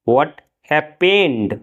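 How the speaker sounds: stretched out, word by word; very slow. Shown as a demonstration. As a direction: drag it out for learners to copy. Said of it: "क्या हुआ?". (बहुत धीरे, शब्द-शब्द)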